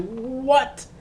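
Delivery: high, stunned what; shocked what. Retold or imitated. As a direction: caught off guard. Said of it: "I'm like".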